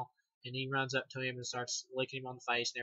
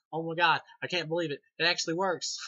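louder and higher, astonished that it works; a hammed-up shocked exclamation; amazed; 4 semitones higher, 7 dB louder than usual. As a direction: blurt it out in happy disbelief. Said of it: "and he goes".

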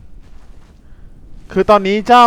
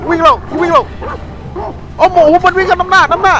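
very high, loud frantic shrieks; real panicked screaming; 12 semitones higher, 4 dB louder than usual. Real, not acted. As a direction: shriek in terror while running from wolves. Fleeing wolves in game. (กรีดร้องตื่นกลัวจริง เสียงสูงมาก ดังมาก)

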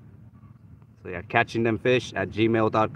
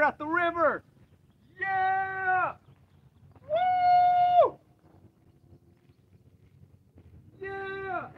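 high triumphant whooping; celebrating the catch; cheering; 8 dB louder, slower than usual. Real, not acted. Landing the catfish, cheering.

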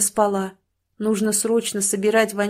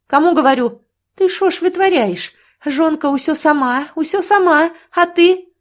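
much higher, louder tirade; furious scolding; 8 semitones higher, 8 dB louder than usual. A narrator's reading. Sister berating her brother for not helping his wife, furious yelling. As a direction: tear into him for leaving his wife alone.

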